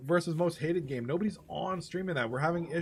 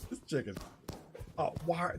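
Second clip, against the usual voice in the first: normal talking voice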